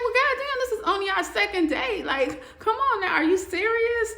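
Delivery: can't believe what he just heard; shocked disbelief. Retold or imitated. Man answering a boyfriend proposal on date two, shocked and loud.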